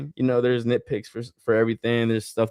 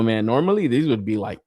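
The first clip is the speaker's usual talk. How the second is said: normal talking voice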